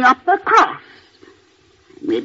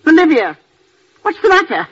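sharp call; 9 semitones higher than usual. Acted. Aunt summons her companion, high and sharp. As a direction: snap her name out to demand attention.

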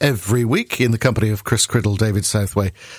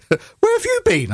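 much higher, slow mock-shrill wife's question; imitating the wife; slower than usual. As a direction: play the cross wife, high and drawn out. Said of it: "my first wife said".

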